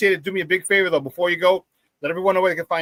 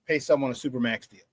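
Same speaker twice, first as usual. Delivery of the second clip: ordinary delivery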